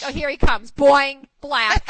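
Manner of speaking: loud, punchy play-by-play; mock exclamation. Wife telling Dana about fighting with Bill, mock exclamation. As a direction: punch out the cartoon noises loudly.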